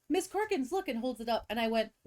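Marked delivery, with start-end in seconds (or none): 0.1-0.9 put-on excited kid call. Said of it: "And he goes".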